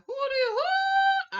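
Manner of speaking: high, slow mock outrage; a sharp disbelieving squeal, drawn out; mock shocked who; incredulous. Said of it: "Just be like".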